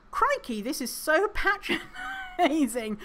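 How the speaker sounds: high, laughing Australian crikey; Australian crikey, pitched high with a laugh; mock Australian exclamation. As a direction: squeal it like a shocked Australian. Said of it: "crikey is very Australian".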